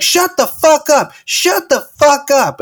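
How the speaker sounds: high, slow shouted order, twice; mock yelling. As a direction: yell at a noisy restaurant crowd. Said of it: "then she's like".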